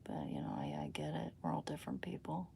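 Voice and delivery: soft unvoiced whisper; whispered aside. Real, not acted. Streamer whispering an aside to chat, hushed.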